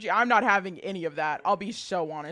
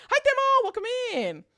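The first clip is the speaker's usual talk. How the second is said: high bubbly greeting; cute anime streamer; 13 semitones higher, 3 dB louder than usual. Real, not acted. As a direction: squeal a welcome like a cute streamer. Greeting viewer.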